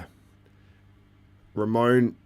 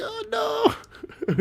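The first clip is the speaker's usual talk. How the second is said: high-pitched mock wail; mock dismay. Imitating interviewers as Ramon walks off, mock dismay.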